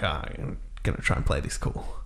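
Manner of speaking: very quiet, low aside; muttered to himself. Retold or imitated. Suspect planning to play it cool, low hushed aside. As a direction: drop right down as if thinking aloud. Retold private thought to himself.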